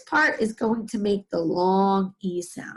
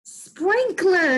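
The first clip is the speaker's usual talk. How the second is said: Sprinkler said high and bright; teacher celebrating the answer; excited answer; 8 semitones higher than usual. Shown as a demonstration. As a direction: announce Sprinkler with delight. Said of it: "What is it?".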